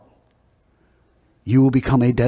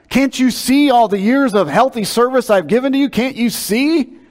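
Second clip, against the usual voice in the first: indignant scolding; 9 semitones higher, 3 dB louder than usual. Acted. Preacher as the donkey scolding Balaam, indignant. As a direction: berate the prophet in hammed-up disbelief. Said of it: "The first words would have been".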